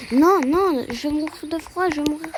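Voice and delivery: high-pitched gamer panic, loud opening cry; playful panic. Pretend. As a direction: panic over dying in the game. Freezing to death in the game. (panique de joueur, voix aiguë)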